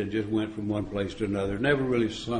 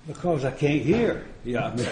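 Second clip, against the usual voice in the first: usual speaking voice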